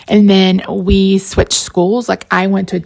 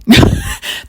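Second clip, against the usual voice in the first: very high breathy squeal of a laugh; squeaky laugh. Real, not acted. Fan gushing over a TV couple, squeaky laugh. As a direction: let out a squeaky giggle.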